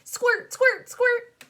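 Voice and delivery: high, loud squirt call; squirt sound effect. Acted. Clown girl playing seltzer at her mirror, high squeaky squirts. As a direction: squeal the word like a squirt bottle. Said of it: "I said again".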